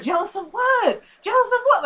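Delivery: high, incredulous mock question; indignant retort. Retold comeback to being called jealous, indignant. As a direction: throw the accusation back, pitch way up.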